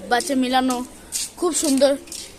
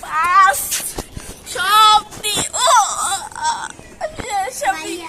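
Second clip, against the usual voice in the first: straining shout; 5 semitones higher, 7 dB louder, much slower than usual. Real, not acted. Last push-up effort.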